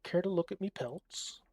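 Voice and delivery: a trapper hawking his pelts in Irish; Irish trapper accent. Acted. Streamer voicing the trapper to the player in light Irish. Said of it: "Probably has an Irish or Scottish accent".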